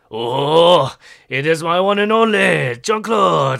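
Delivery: loud, high French baker voice; overjoyed baker shouting a friend's name; Pierre greeting. Acted. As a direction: boom out a delighted French welcome. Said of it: "he's like".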